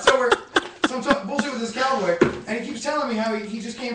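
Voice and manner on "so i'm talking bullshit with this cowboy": ordinary delivery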